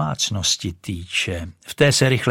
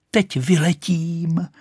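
excited cry; 8 semitones higher, slower than usual. Acted. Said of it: "křičela".

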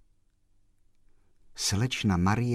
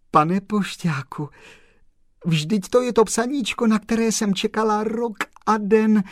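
very high, louder, overjoyed voice; tearful joy; 13 semitones higher, 7 dB louder than usual. A narrator's reading. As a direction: pour out joy, climbing high. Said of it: "oči se jí zalily slzami radosti". (radostně, vysokým hlasem)

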